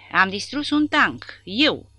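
loud, high, proud bellow; triumphant yell. Acted. Giant soldier boasting to comrades about his tank, loud triumphant yell. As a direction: roar it out while beating your chest. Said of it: "urlă el bătându-se cu pumnii".

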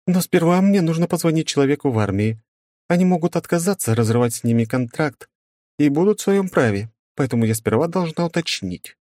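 a school directress explaining; woman's voice. Acted.

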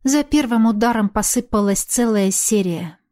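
ordinary delivery

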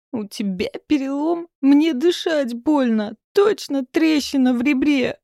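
high, loud, slow groaning whine; pained groan. A narrator's reading. Max in the back seat groaning about his ribs. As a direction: whine it through aching ribs. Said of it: "простонал Макс".

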